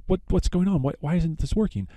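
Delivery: puzzled panic. Retold or imitated. The couple squeezes the dry cow, mildly panicked and confused. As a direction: play it baffled and rising. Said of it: "they're saying".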